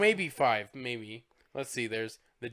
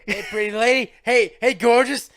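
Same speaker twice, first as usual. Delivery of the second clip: high, loud, brash come-on call, hamming it up; loud sleazy greeting; 9 semitones higher, 13 dB louder than usual. Retold or imitated. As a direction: shout the compliments like a cheesy flirt. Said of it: "he just talks like that".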